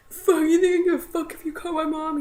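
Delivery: high, loud, angry outburst; retold screaming. Daughter fighting dad's threat to call mom, angry yell. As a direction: throw the threat back at him, high-pitched. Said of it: "me screaming and doing the whole".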